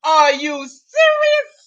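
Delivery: slow, stretched incredulous cry; are you serious, drawn out; mock disbelief. Real, not acted. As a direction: drag it out in disbelief. Catchphrase, cry-like shout.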